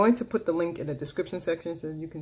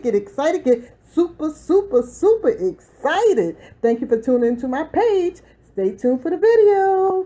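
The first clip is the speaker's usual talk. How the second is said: much higher and much louder, pumping up the crowd; hype-man intro; 10 semitones higher, 14 dB louder than usual. Acted. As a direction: announce it like a stadium MC.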